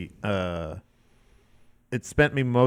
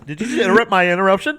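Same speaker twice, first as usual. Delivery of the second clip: high, fast, louder protest; voice shooting high; 6 semitones higher, 5 dB louder, much faster than usual. Real, not acted. Host challenging co-host over interruption, voice suddenly high. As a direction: rush it out in a raised pitch. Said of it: "Your voice just got really high".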